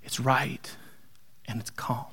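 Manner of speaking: hushed awed whisper. Acted. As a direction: let calm fall to breath. Imagined disciple, voice falls.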